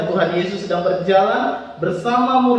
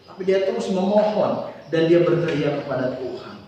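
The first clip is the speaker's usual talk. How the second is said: ordinary delivery, nothing marked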